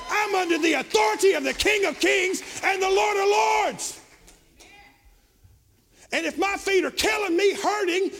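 loud, high, rising preacher's cry; preaching at full force. Acted.

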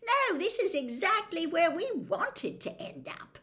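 high-pitched mock cheer; very sarcastic. A narrator's reading. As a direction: pretend this is just perfect. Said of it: "very sarcastically".

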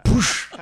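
loud burst of a crash sound; imitated impact noise. Dad acting out his son hitting the sand, loud imitated impact. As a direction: punch out the thud of a landing.

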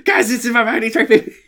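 high, laughing panicked whine; panicked Cartman. Retold or imitated. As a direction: squeal it like a freaked-out Cartman. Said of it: "He's like".

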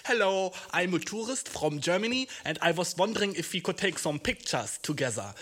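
put-on English with a heavy German accent; thick German tourist accent in English. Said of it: "was ist, wenn ich einfach ohne Akzent rede".

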